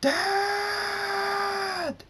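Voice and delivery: very high, long drawn-out yell; piercingly high cry after a departing father; screaming. A narrator's reading. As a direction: scream the word and hold it. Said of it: "Lunar screams".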